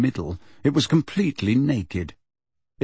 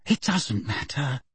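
softer, slow hush; Hermione whispered; 2 semitones higher, 6 dB quieter than usual. A narrator's reading. Hermione soothing Ron and Ginny, softly. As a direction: say it softly to calm the others. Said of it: "Hermione whispered".

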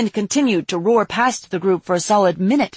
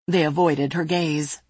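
plain reading voice

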